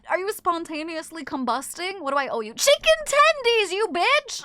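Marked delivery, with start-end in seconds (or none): 2.5-4.4 high, loud, slow whiny shout of "Chicken tendies"; mock bratty demand. Streamer voicing chat demanding tendies, bratty. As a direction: yell it like a spoiled brat.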